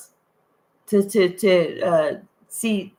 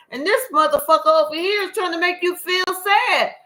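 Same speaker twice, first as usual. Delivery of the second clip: much higher, fast, disbelieving rant; exasperated rant; 11 semitones higher than usual. Acted. Reader scolding the person for choosing sadness: exasperated high rant. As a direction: throw up your hands and scold them.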